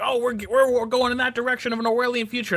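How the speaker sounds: high, alarmed doom-saying; mock a worried conspiracy theorist; paranoid voice. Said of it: "that sounds like paranoid talk".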